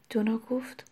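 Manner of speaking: dropped to a whisper; دونا گفت whispered softly; whispered tag. A narrator's reading. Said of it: "narration tag".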